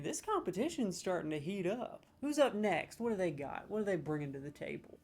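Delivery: ordinary delivery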